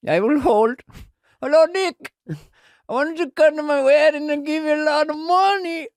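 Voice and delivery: put-on high, bright Indian accent phone caller. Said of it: "I'm doing Indian accents now".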